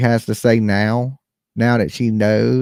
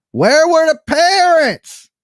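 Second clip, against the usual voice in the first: high, loud, drawn-out Nancy impression; 5 dB louder, slower than usual. Host demanding to know about the parents, loud Nancy impression. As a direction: call it out slowly in a high put-on voice. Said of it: "In my best Nancy voice".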